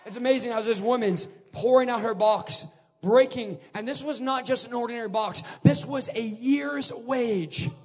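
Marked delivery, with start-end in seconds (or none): none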